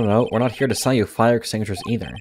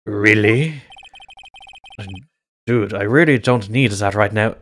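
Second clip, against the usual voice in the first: hammed-up, badly faked French accent; terrible French accent; 5 dB louder than usual. Shown as a demonstration. Player voices the Samurai on request, terrible French accent. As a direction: put on a deliberately bad French accent. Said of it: "Do it with the French accent".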